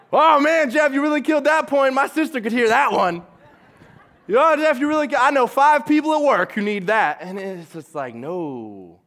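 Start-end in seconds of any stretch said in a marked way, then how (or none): 0.1-3.2 high, loud, gushing praise for the pastor; mock smug churchgoer. Churchgoer to pastor Jeff, high loud mocking gush. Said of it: "I immediately think of who else they apply to". 4.3-7.2 high, loud, gushing praise for the pastor; mock smug churchgoer. Churchgoer to pastor Jeff, high loud mocking gush. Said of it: "I immediately think of who else they apply to".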